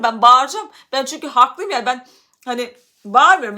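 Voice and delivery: loud, heated yelling back at a coworker; retold shouting. Worker yelling at a lying coworker, loud and defiant. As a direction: shout it out, refusing to calm down. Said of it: "ama ben bağırıyorum".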